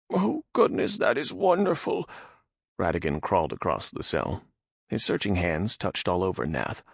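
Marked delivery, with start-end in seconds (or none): 0.1-2.1 high nervous man voice; frightened Radagon. Acted. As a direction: ask in timid fear. Radagon relieved.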